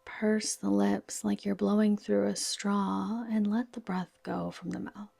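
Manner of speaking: ordinary delivery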